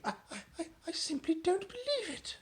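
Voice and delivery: stammering, high disbelief; stunned murmur. Acted. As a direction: stutter in shock. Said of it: "the Queen was murmuring".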